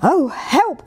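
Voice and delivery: high, startled little yelp; mildly alarmed cry. A narrator's reading. Little tiger startled at the pond, mild alarm. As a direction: cry out with a touch of fright. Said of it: "cried little Tiger".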